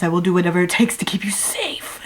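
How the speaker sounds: whisper. Acted. Possessive vow, whispered.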